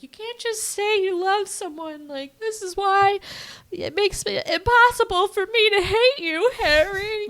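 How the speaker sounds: very high mock-mad protest; Sally. Friend re-enacts her telling him off, high mock anger. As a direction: imitate an upset woman, voice pushed way up. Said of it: "she's mad because".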